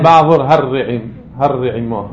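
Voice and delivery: הרעימו with a doubled, rolled r; dagesh in the resh. Shown as a demonstration. Teacher confirming a student's example, doubled resh in הרעימו. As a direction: lengthen the ר in הרעימו. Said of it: "עוד דגש ב-, ברש".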